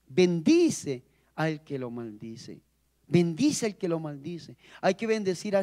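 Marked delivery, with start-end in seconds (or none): 0.1-2.6 slow. Shown as a demonstration. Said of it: "repeats the verse slowly, then again".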